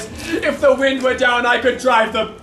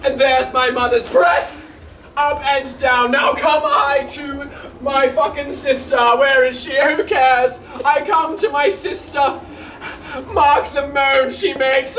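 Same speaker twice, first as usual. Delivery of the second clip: rueful clown lamenting his dog; comic servant Launce. Acted. Launce to the audience, a mock-tearful clown. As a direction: play the weeping fool for laughs.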